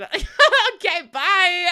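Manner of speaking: thanks breaking into loud laughter; gleeful laughing. Real, not acted. Streamer banishing a hateful chatter, gleeful laughing. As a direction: burst out laughing on the sign-off.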